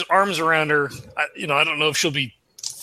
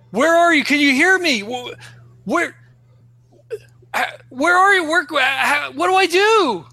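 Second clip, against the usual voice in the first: high, panicked stammering cries; frantic calling; 8 semitones higher than usual. Acted. Player as Jack calling to the vanished Susie, frantic calling. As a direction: call out desperately, breaking off and restarting.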